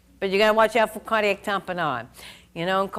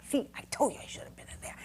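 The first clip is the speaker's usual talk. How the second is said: whisper; 3 semitones higher, 12 dB quieter, faster than usual. Retold or imitated. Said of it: "So I'm like".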